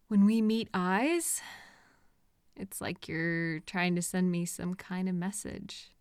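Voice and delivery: normal talking voice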